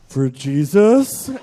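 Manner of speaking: slow, loud, low and earnest; solemn for Jesus. Retold or imitated. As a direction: say it dead serious and drawn out. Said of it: "I had to be like".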